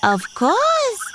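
high, sweet doll voice. Acted. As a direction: speak up high as a little doll. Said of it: "pretending to be the doll".

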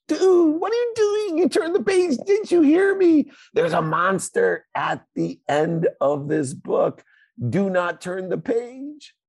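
high, loud panicky Muppet voice; hammed-up high Grover yelling at the reader; frantic Grover. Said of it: "he's going crazy on the next page".

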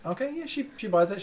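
ordinary talk, nothing marked

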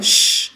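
breathy cat hissing. Acted. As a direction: hiss like an angry cat. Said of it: "зашипела".

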